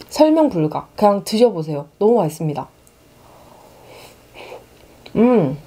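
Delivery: chewing while talking; speaking between bites; mouth full. Real, not acted. Seen 5: Cheeks full and chewing at start; takes more bites later.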